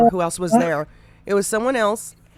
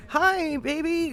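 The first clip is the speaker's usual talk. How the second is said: imitating a caught husband, higher and slow; lightly voiced sheepish husband; fake sweet greeting; 8 semitones higher, slower than usual. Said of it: "kind of shit".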